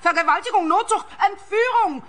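high shrill outcry for help; shrieking. Acted. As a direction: scream it to the whole gym. Woman grabbed, crying out.

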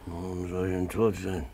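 normal talking voice

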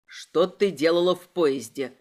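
low questioning voice; low-pitched inspector; stern interrogator. A narrator's reading. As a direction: question her low and firmly.

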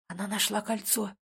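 soft, hushed realization; whisper. A narrator's reading. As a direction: murmur it under the breath. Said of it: "прошептал брат".

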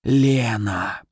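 lightly voiced serpent, drawn out; a hint of a hissing snake; snake's answer. A narrator's reading. Fire snake naming who was in control, lightly hissing. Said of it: "быстро ответила змея".

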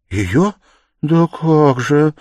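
drawn-out, pained cry, high and loud; plaintive. A narrator's reading. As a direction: stretch it out with suffering. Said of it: "протянула Соня жалобно и с страданием". (жалобно, протяжно, с страданием)

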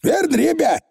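squeaky little Scottish pixie; high, rough tiny rascal; Feegle voice. Acted.

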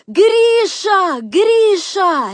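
high, drawn-out cry for help; piercingly high, loud wail; desperate scream. A narrator's reading. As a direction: scream the name in despair. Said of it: "отчаянным воплем закричала она".

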